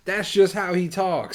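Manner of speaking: loud, high, slowed-down imitation; imitated all-caps talk. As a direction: mimic someone who always talks in capitals. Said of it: "He's not yelling".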